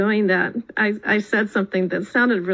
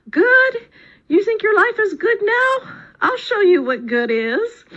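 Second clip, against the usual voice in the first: higher, louder booming joy from God; delighted roar; 12 semitones higher, 4 dB louder than usual. Acted. God laughing at the grateful farmer, delighted roar. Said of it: "roared with delighted laughter".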